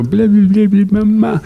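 put-on high, loud baby babbling; mimicking a baby's first words; toddler babble.

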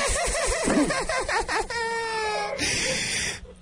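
high, teasing cackle at the seller; mocking laugh. Prank caller laughs at the pool seller, mocking cackle. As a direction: laugh her off like she's clueless.

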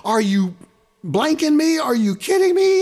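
high, loud are you kidding me; yelling at the broken string; exasperated outburst. Retold or imitated. Retelling snapping strings on stage, high exasperated yell. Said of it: "I'm like".

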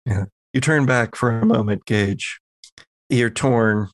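ordinary delivery

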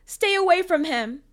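high, very loud panicked scream; panicked shout. A narrator's reading. Girl defending her little brother, panicked shouting. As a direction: shout in panic to protect someone. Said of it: "She panicked, shouting".

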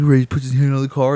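ordinary talk

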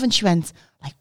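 normal talking voice

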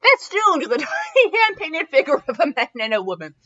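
loud, high, giddy delivery; giddy reading. Real, not acted. Reader resuming the story still tickled by festooned, giddy. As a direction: read on while bubbling over the first word.

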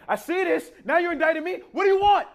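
very high, loud protest to God; sarcastic exasperation. Acted. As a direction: build to an exasperated demand. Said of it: "get a bit sarcastic with God".

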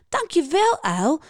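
high-pitched, delighted little call. A narrator's reading. Schildpad feels wonderfully light and thanks Uil, calling out. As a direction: call out your thanks, voice lifted. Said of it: "roept hij".

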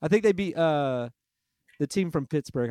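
ordinary talk

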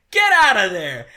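"Get out of there!" yelled loud and high, laughing; shouting. Retold or imitated. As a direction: bark "Get out of there!" like a frantic Doc. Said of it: "and it cuts to him going".

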